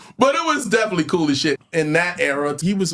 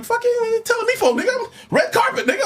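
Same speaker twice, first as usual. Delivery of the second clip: higher, mock outraged comeback; indignant retort. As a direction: act offended at your brother bossing you. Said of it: "I'm like".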